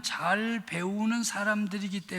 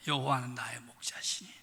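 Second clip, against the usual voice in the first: soft whisper; 8 semitones lower, 8 dB quieter than usual. Shown as a demonstration. Quoting a well-known psalm softly.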